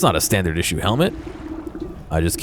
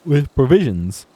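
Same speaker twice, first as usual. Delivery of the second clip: nervous quartermaster; 6 semitones higher than usual. Acted.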